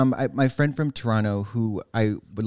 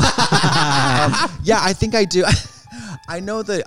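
laughing through the words, higher; 8 semitones higher, 4 dB louder, slower than usual. Real, not acted. Guest laughs while claiming to know Canada. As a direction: giggle as you answer.

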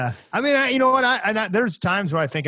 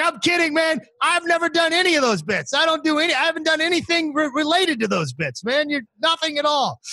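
hammed-up squeaky reassurance; high mock voice of Brian. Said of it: "He's like".

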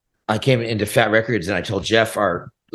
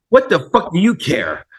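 indignant comeback, pitched up; retold indignant challenge; 8 semitones higher, 4 dB louder than usual. Boss challenging the employee who yelled at him, indignant. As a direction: throw it back at him, higher and heated. Retelling his quarrel with label manager.

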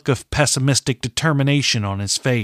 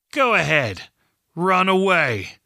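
higher, goading villain's jeer; taunting; 6 semitones higher than usual. Acted. Master Edling goads Katrin to abandon her father, taunting. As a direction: dare her mockingly to leave.

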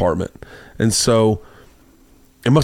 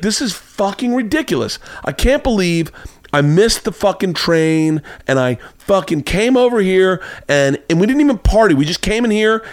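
higher-pitched imitation of her fuming; retold angry rant; 8 semitones higher than usual. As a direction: complain indignantly, mimicking an angry date. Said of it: "like, really angry".

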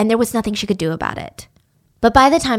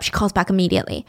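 ordinary talk

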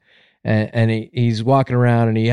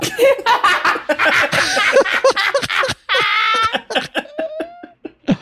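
high-pitched, drawn-out laughter; long laugh. Real, not acted. Comedian cracking up after a long joke, high laughter. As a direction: laugh hard and keep it going.

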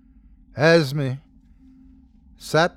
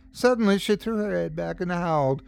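plain reading voice

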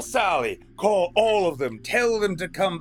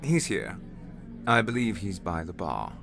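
dismissive; 5 dB quieter than usual. A narrator's reading. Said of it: "the waiter said dismissively".

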